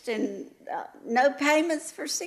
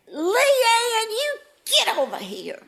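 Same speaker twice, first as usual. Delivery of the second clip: mock scolding; 10 semitones higher, 4 dB louder, slower than usual. Teacher retells calling the girl over, mock scolding. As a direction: call the naughty pupil over.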